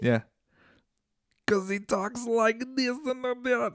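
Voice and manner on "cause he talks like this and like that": slurred, high, slow caricature voice; exaggerated bad celebrity impression, pitched up and dragged out; Bill Cosby. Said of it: "I have a horrible Bill Cosby impression".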